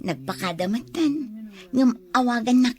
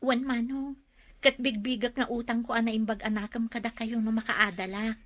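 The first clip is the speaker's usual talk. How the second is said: softer, earnest vow; sincere promise; 7 dB quieter than usual. Acted. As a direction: promise it gently and truly. Promising brother to repay him. (ti ading nga agkari iti manongna, naalumamay)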